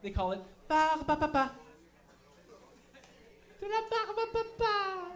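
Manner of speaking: mocking the French for cotton candy; mock French word. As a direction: squeal "bar ba ba ba" in a high silly French voice. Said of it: "what they call cotton candy in French".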